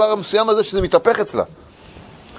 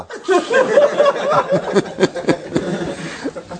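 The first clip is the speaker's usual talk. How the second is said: long high airy laughter; 3 dB quieter than usual. Real, not acted. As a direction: let the laugh run on. Laughing at own joke. (צחוק ארוך וגבוה)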